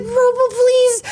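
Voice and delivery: high, drawn-out mock-whiny plea; desperate whine. Traveler silently begging the slow attendant, whining. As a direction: whimper it in exaggerated desperation.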